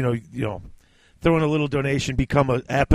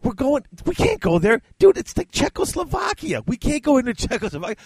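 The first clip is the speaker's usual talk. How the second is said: much higher, pleading movie-buddy voice; high excited movie quote; 11 semitones higher than usual. Retold or imitated. Quoting a favorite Stripes line to his co-host, high and pleading. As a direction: beg him to cross the border, pitched way up. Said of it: "one of my favorite lines ever".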